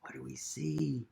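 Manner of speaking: whispered suspense question. A narrator's reading. Reader building suspense for children, whispering.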